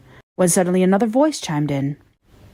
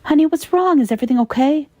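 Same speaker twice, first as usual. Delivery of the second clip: alarmed call; 8 semitones higher than usual. Acted. Worried call through headset.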